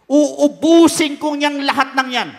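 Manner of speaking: loud furious threat from God; God vows to wipe them all out, loudly furious; angry God. Acted. Angry God swearing to destroy the people, loud and furious.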